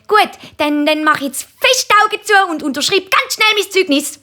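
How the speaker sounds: hohe, eifrige Bubenstimme; Kinderstimme. A narrator's reading. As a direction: sag es hoch wie ein gewitzter Bub. Markus überlistet den Papi. (hochi, ifrigi Buebestimm)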